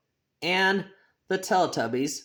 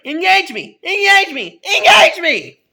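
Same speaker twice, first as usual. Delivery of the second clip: put-on much higher, louder screaming child; tantrum shouting; 13 dB louder than usual. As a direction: scream it like a spoiled kid's tantrum. Kid's tantrum at the library.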